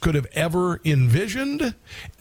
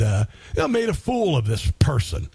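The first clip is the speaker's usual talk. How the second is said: ordinary talk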